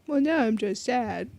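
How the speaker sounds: ordinary talk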